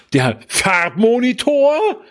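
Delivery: high, slow, wide-eyed astonishment; mock amazement. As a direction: gasp it out in fake awe.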